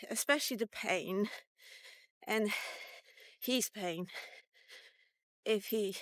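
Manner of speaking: usual speaking voice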